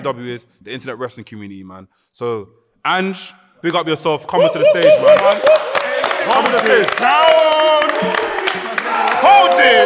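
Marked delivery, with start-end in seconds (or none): 4.3-9.9 hype shout. Pretend. Hyping entrance.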